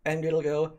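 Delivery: ordinary talk, nothing marked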